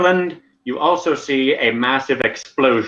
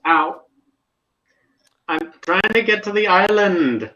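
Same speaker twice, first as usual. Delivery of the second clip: higher-pitched whine of a fallen adventurer; lightly acted cry of complaint, pitched up; pained complaint; 9 semitones higher than usual. Game master voicing the fallen Ornstein, pained complaint. GM voices a character who fell.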